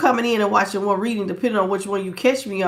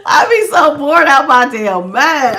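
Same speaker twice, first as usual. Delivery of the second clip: laughing; 5 semitones higher, 8 dB louder than usual. Real, not acted. Laughing while talking.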